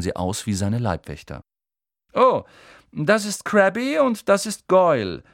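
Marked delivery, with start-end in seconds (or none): 2.1-5.2 offhand, high and lofty introduction; casual arrogance. A narrator's reading. Malfoy casually introduces his two bodyguards, lofty. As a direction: toss the names off as if they bore you. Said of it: "bemerkte der blasse Junge lässig".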